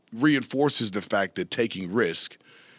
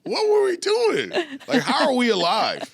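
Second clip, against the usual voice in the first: high, incredulous what-the-fuck; reliving the shock of it all, half laughing; amazed disbelief; 12 semitones higher than usual. Retold or imitated. Guest recalling his past self, stunned and laughing.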